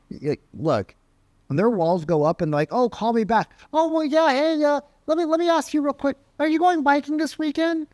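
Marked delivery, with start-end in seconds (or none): 2.7-7.9 high, fast fake-friendly babble; nervous rep voice pitched far up; imitating a pushy salesman. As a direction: chatter on way up high to dodge the hang-up. Prospect's brush-off, then rep's fake chatter.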